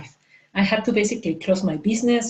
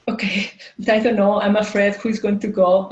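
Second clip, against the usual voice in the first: ordinary delivery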